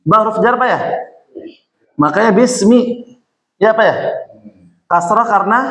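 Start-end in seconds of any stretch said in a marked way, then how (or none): none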